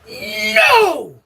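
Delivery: very high, loud drawn-out scream; booming yell. Retold or imitated. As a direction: bellow it to stop someone in danger. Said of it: "it came boom, like I inherited my dad's voice".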